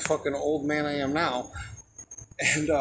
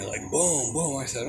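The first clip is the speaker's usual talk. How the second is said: normal talking voice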